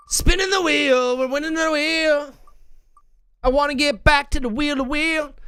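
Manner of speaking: high sing-song announcer voice; hyped wheel announcer. Acted. Streamer hyping chat for the wheel spin, high announcer voice. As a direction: hype up the wheel like a game host.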